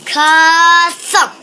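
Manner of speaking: loud, high, stretched KA then a thump; booming sound effect. A narrator's reading. Narrator voices candy landing in the bag, booming effect. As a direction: boom out the thud with a long first syllable.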